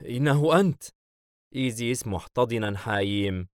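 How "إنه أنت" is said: mildly delighted, higher pitch; a touch of happy amazement; smiling recognition. Acted. Hayim recognises old friend, smiling recognition. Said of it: "حايم باسما".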